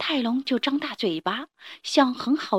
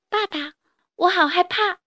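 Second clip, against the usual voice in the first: put-on scared child; 7 semitones higher than usual.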